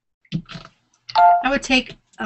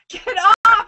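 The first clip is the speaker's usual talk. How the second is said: panicked yelp; 9 semitones higher, 4 dB louder than usual. Real, not acted. Panicking over the fish.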